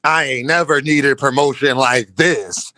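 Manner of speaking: deep, drawn-out mock tweet read-out; imitating Clarissa's tone. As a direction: read her tweet low and slow, punching each dot. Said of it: "You know that's how she was saying it when she tweeted it".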